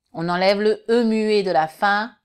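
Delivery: ordinary delivery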